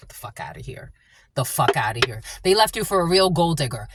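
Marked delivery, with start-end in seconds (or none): none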